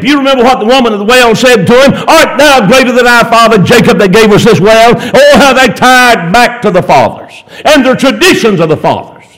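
high, driving sermon cadence; full-force preaching. Real, not acted. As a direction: proclaim it to the congregation in rolling phrases.

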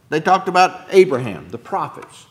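ordinary talk, nothing marked

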